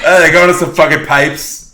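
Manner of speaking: mock a bored dad narrating; bored dad impression. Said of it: "bored dad describing".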